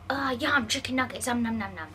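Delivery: loud, gloating nom-nom voice; mocking a meat eater. Buffet guest teasing the vegan with nuggets, mock gloating. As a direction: ham up someone savouring nuggets. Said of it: "someone was like".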